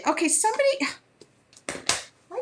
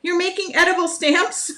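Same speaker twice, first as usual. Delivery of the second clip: high, loud, thrilled disbelief; a delighted, raised cry of surprise; excited squeal; 8 semitones higher, 6 dB louder than usual. Retold or imitated. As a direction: gasp it out like you can't believe it's real. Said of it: "I was getting so excited".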